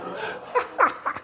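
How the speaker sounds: a high, breathless burst of laughter; high wheezy laugh. Real, not acted. Ex-boxer laughing at the champ's punch, high laugh.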